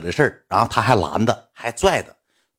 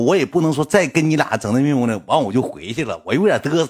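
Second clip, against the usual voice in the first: normal talking voice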